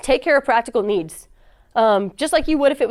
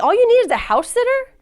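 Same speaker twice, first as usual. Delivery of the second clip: eager surprise; 12 semitones higher, 4 dB louder than usual. Retold or imitated. Offering boss a solution, retold.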